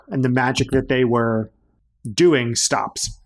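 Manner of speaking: ordinary talk, nothing marked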